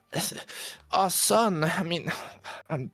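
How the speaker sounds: high, halting, stammering woman's voice; mother losing her children, voice breaking. Acted. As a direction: falter as if holding back tears. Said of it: "her voice start to stammer more and start to break down".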